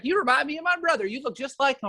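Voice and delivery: put-on quick, high Georgian accent. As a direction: imitate a chatty Georgian lady in a high voice. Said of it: "like, Georgian accent".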